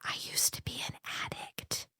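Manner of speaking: hushed, voiceless whisper; whispered confession. Retold or imitated. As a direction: confide it in a very soft whisper. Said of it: "and I whispered".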